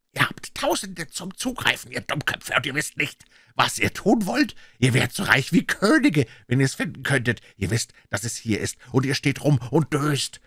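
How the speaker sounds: high, agitated harangue; exasperated rant. A narrator's reading. Blind leader to dithering gang, exasperated rant. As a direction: shame the hesitant men with rising frustration.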